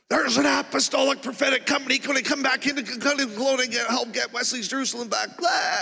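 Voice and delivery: high, loud torrent of prophecy; ecstatic freak-out. Retold or imitated. Waking from a dream and freaking out, ecstatic prophesying. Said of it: "freaking out for five minutes".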